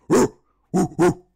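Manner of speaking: loud, high, aggressive ooh-ooh; aggressive gorilla hoot. Shown as a demonstration. Showing viewers the gorilla with aggression, loud hoots. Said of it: "a bit of aggression behind it".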